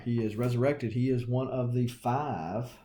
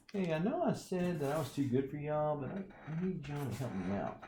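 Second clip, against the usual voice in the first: whiny Sunfire; 5 semitones higher, faster than usual. Retold or imitated. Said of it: "Sunfire likes to whine".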